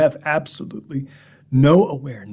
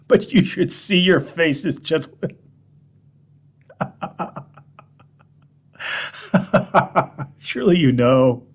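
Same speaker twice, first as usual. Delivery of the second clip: laughing; 4 semitones higher than usual. Acted. Laughing off the story.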